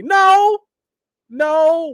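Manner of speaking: high, loud excited no; emphatic no. Real, not acted. Host to a viewer, excited high denial. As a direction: squeal the denial. Recalling hundred-follower days.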